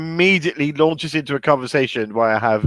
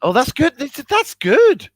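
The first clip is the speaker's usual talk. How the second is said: mock high voice; 13 semitones higher, 4 dB louder than usual. Mocking the impression back.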